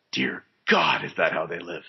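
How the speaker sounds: shocked hushed whisper, then dropping; horrified whisper. Retold or imitated. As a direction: whisper it in mock horror. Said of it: "just to be like".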